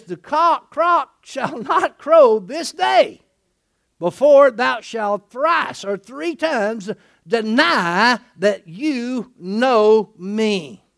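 very slow, weighty King James delivery; solemn slow prophecy. Acted. Jesus foretelling Peter's denial, slow and solemn. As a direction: drag each word out like a solemn oracle.

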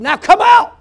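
loud, high command to the demon; commanding shout. Retold or imitated. Preacher ordering the demon to leave, a commanding shout. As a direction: order it out with full force.

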